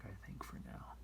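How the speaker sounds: whispered under the breath; a quick whispered afterthought; whispered aside. Real, not acted. Muttered aside about lighting.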